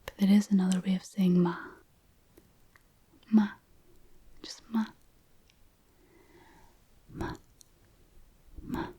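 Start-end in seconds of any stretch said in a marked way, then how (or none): 3.3-8.9 Ma short and light with almost no tone; neutral tone ma. Shown as a demonstration. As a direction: say Ma flat and toneless. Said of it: "Almost no tone at all".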